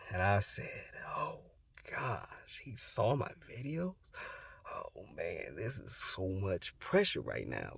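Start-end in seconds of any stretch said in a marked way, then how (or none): 1.2-7.8 soft, near-whispered acted panic; hushed dread. Grandson learning grandpa saw his videos, hushed mock dread. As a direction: shrink to a soft aside under pressure.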